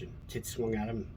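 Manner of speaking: normal talking voice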